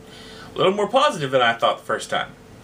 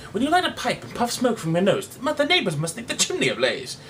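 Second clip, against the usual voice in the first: truculent; 8 semitones higher than usual. Acted.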